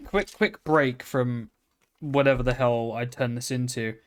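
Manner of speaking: ordinary delivery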